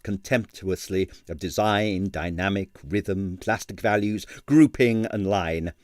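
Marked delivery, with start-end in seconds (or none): none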